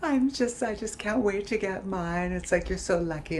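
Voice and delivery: high, wailing mock sob; fake crying. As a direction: turn a laugh into a put-on cry. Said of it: "turned the laughing into crying".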